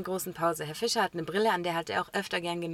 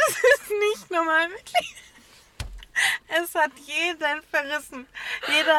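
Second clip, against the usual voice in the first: laughing; 8 semitones higher, 4 dB louder than usual. Real, not acted. Describing the crooked glasses, laughing hard. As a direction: barely get it out for laughing.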